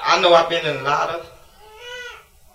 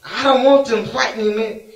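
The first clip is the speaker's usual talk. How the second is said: usual speaking voice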